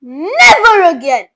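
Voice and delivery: loud, slow firm shout. A narrator's reading. Father shouts his refusal at his son. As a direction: lay down the law. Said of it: "shouted Mister T V".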